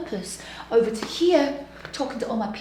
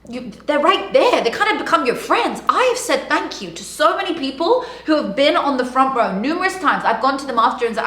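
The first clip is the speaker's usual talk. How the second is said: ordinary delivery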